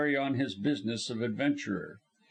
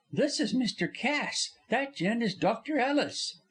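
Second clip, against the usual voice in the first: high, bright stage star voice; lively actress; 10 semitones higher than usual. Acted. Actress Polly babbling to her visitors, high. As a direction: chatter on like a cheerful star.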